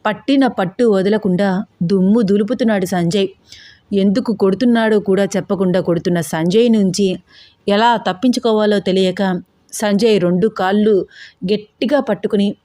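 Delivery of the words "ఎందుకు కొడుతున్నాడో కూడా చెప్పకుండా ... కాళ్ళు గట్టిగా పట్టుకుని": ordinary delivery, nothing marked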